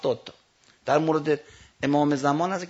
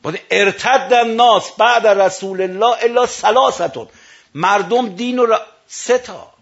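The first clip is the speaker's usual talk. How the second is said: higher, louder and slow Arabic recitation; preaching at full force; 7 semitones higher, 8 dB louder, slower than usual. Acted. Preacher proclaiming the hadith to the mourners, loud and slow. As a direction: declaim the hadith slowly, raising the voice.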